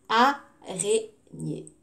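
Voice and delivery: "Araignée" slowly split a, rai, gnée; syllable by syllable. Shown as a demonstration. Teacher lets pupils hunt the A, syllable by syllable. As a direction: separate each syllable of "Araignée" with gaps.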